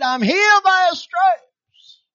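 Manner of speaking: proclaiming it with big excitement; excited. Retold or imitated. Churchgoer shouting their healing after a good sermon, excited. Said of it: "we get all excited".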